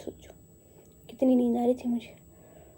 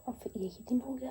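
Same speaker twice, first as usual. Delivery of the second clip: much softer, whispered; 11 dB quieter than usual. Real, not acted. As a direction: let it fade into a whisper. Late night, quiet talk.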